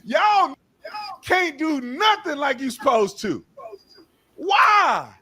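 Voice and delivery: high, slow, shouted disbelief; exasperated high shout. Real, not acted. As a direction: shout at him for speeding. Exasperated rant at the driver.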